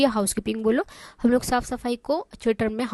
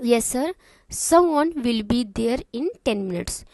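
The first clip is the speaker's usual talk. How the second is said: slow, even reading; slow and clear; much slower than usual. Shown as a demonstration. Teacher reading the receptionist's answer slowly. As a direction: read it slowly for beginners. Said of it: "मैं इतना आराम आराम से इसलिए बोल रही हूँ".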